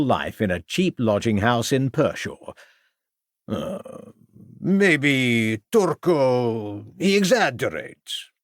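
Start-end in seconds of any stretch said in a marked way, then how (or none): 3.5-8.3 put-on broken English in a foreign accent, hesitant; a shy foreign violinist who fumbles his English; embarrassed foreign musician. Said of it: "admitted the embarrassed musician".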